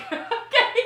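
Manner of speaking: ordinary talk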